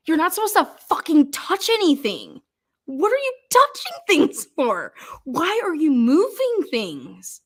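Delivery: high-pitched, incredulous scolding; exasperated rant. Real, not acted. Speaker berates crime scene team for moving evidence, exasperated. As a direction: stretch the questions out in disbelief. Ranting at investigators who moved evidence.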